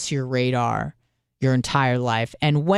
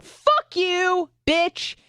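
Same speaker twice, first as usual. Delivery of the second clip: high, squeaky mock retort; mock outburst; much slower than usual. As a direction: snap back in a shrill comic squeal. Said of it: "I'm like".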